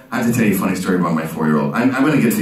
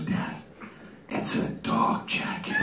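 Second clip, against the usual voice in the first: slow, hushed stage whisper; confiding whisper; 12 dB quieter, slower than usual. Acted. Daughter secretly tells dad about grandma's gift, acted slow whisper. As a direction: whisper it slowly, as a secret revealed.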